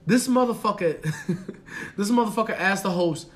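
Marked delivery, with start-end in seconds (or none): none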